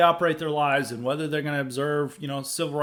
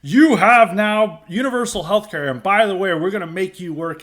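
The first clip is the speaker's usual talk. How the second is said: louder, higher barked announcement from a mock officer; loud mock military decree; 4 semitones higher, 9 dB louder than usual. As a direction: ham up a gung-ho uniformed official making a decree. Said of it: "guys in cool military outfits who are like".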